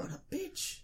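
squeaked up high in mock disbelief; high squeaky outrage. Real, not acted. Friend venting about the manager in a squeaky, outraged voice. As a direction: pitch way up, mock scandalized.

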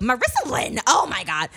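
loud, high mock scolding mom; Pam. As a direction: call out the full name like an exasperated mother. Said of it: "That's Pam whenever I do her".

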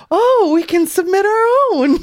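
high, gleeful exclamation; mock delight. Co-host reacting to the upload invitation, mock delight. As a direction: pitch it up in put-on excitement.

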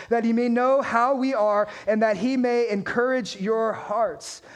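normal talking voice

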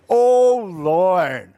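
loud, drawn-out grumbling complaint. Acted. Jonah complaining to God in verse two, grumbling. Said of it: "He's grumbling".

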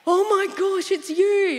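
high, loud acted amazement; astonished recognition. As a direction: exclaim as if spotting your rescuer. Retold man recognizing her with amazement.

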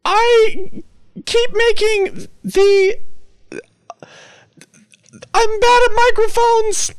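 put-on very high squeaky old woman voice; squeaky old lady. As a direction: squeak it like a frail, flustered granny. Caller Brenda, a flustered old lady.

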